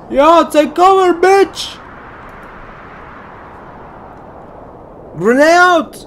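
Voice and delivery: high, loud frantic yell; screaming callout. Real, not acted. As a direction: scream it over the gunfire. Throwing grenades in shooter game.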